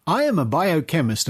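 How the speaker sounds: ordinary delivery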